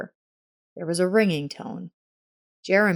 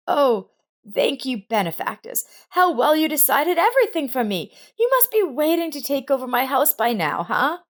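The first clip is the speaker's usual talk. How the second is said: angry; 9 semitones higher than usual. A narrator's reading. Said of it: "Frida was angry".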